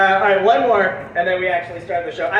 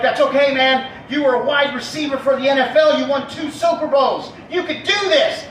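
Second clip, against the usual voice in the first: raised, emphatic pep talk; 6 semitones higher, 3 dB louder than usual. Acted. Colleagues urging the ex-player on, rousing raised voice. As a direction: cheer him on, louder and higher.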